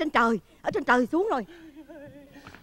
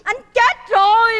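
slow, loud comic wail; 4 semitones higher, 6 dB louder, slower than usual. Acted. Widow tells the visitor her husband died, wailing. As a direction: cry it out, high and drawn out.

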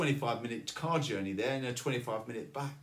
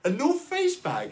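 high, loud, disbelieving repeat; can't believe they have it; incredulous; 12 semitones higher, 6 dB louder than usual. Retold or imitated. Asking staff about his bag, high loud disbelief. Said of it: "And I said".

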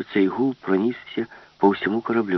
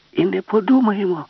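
loud, much higher gleeful yell; joyful shout; 11 semitones higher, 6 dB louder than usual. Acted. Alice defies the ship's brain with a joyful yell. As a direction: shout it with glee. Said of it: "радісно закричала Аліса".

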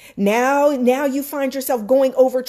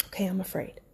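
much softer, almost breathed; barely voiced admission to herself; hushed admission; 3 semitones lower, 11 dB quieter, faster than usual. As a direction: let it fall to a murmur. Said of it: "I'm admitting to myself that I'm afraid".

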